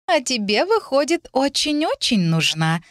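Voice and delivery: put-on high, taunting girl's lilt; teasing. A narrator's reading. Girl keeps the book from heroine, teasing. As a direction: tease her, dragging out the last words. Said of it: "продолжая дразнить меня".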